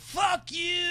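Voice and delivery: high angry yell of the crowd; shouted. Retold or imitated. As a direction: yell it at the president. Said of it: "people are like".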